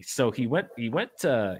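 ordinary talk

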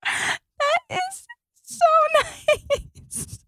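very high, slow, tearful squeak; choked up with happy tears; overcome, near tears. Real, not acted. Moved by brother's surprise voicemail.

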